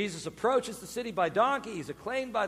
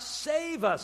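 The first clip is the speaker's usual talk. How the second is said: higher-pitched plea; crying out; 7 semitones higher than usual. Retold or imitated. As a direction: lightly imitate a crowd's cry. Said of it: "when the people cry out".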